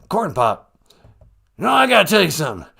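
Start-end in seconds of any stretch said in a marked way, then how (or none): 1.6-2.7 loud, fast, higher blustering politician; Joe Biden. Acted. President pressing the host to listen, blustering. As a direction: barge in urgently. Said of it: "Mister President".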